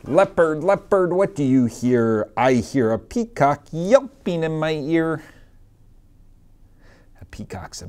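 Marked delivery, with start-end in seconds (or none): 0.0-5.2 lively sing-song picture-book reading; reading to children. Acted. Teacher reading a picture book to her class, sing-song. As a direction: read it out to a class of little kids.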